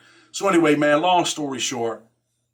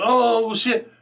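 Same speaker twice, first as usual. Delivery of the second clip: mock surprise; 8 semitones higher than usual. Everybody reacts to the news.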